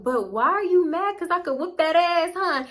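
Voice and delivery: high teasing brag to an annoyed friend; teasing taunt. Retold or imitated. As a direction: poke at her, high and cocky. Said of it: "And I was like".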